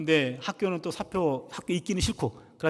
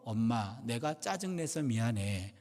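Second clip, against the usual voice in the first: tearful apology; 8 semitones lower, 7 dB quieter, slower than usual. Retold or imitated. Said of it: "울면서".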